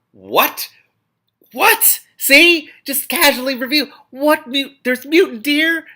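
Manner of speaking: high, loud disbelieving squeal; incredulous. Real, not acted. Reader breaking off the book, shocked at mutant deer.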